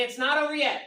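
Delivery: preacher's call to the room; call and response. Shown as a demonstration. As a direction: call it out strong.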